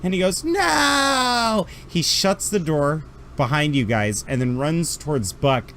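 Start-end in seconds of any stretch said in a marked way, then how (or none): 0.4-1.7 high, loud despairing scream; officer screaming as debris impales his partner; anguished cry. Acted. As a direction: cry out in horror. Officer sees Buck impaled.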